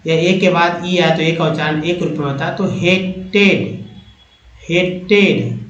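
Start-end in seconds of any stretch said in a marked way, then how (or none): 2.7-5.6 हेटेड said slowly, he-ted with a long e; हेटेड drawn out, ate sound in the middle; slow word demo. Shown as a demonstration. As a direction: spell out हेटेड as hay-ted. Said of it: "ए टी ई को ऐसे भी एट बोलते हैं".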